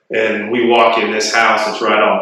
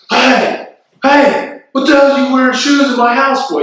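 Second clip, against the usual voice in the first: high-pitched mock yell, getting faster; imitated angry shout, pitched way up; retold screaming; 4 dB louder than usual. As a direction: holler it high like an outraged host. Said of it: "who starts screaming at me".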